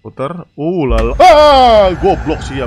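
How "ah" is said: loud, high yelp of shock; startled scream. Real, not acted. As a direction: scream out at a sudden scare. Startled by a jump scare.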